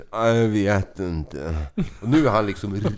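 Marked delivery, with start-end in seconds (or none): 0.1-1.5 very slow, low drawl; drunken cluelessness. Retold or imitated. Drunk friend asked how many cards he had, slow low drawl. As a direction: drag the answer out like a drunk.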